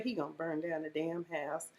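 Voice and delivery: low muttered aside; quiet low grumble about the house. Retold or imitated. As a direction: mutter it under your breath. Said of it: "I was like".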